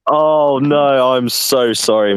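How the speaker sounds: oops, flustered apology; embarrassed surprise. Real, not acted. Realizes he got the country wrong.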